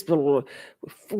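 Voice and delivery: slow, drawn-out dismissive blah; mock bored brush-off. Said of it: "Katie says".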